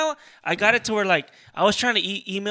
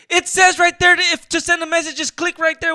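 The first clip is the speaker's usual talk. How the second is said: high-pitched acted-out protest; exasperated defence; 8 semitones higher, 5 dB louder than usual. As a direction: argue back in mock exasperation. Acted argument, defending himself.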